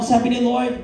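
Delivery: ordinary talk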